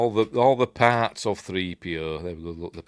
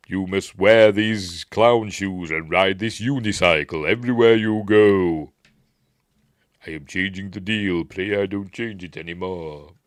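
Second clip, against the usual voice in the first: Vader; 4 dB louder than usual. Retold or imitated. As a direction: lay down absurd terms with deadpan villain gravity. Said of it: "is Vader".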